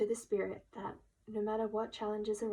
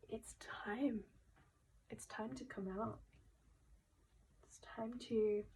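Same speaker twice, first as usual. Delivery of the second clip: emotional hush; 6 dB quieter than usual. Real, not acted. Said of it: "before I get too emotional".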